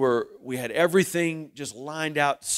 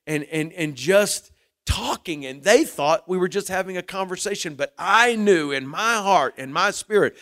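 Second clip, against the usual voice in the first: raised, higher preacher's voice building to a peak; fervent preaching; 5 semitones higher, 6 dB louder than usual. Acted. Pastor urging his congregation, in a raised fervent preaching voice. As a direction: preach it home louder and higher.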